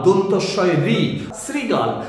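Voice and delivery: normal talking voice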